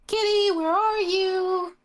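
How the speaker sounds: high, drawn-out call for a lost cat; mock yell, high and sing-out. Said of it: "will just start yelling".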